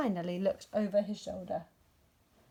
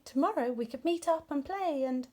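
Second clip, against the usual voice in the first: happy; 6 semitones higher than usual. A narrator's reading. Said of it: "said Rabbit happily".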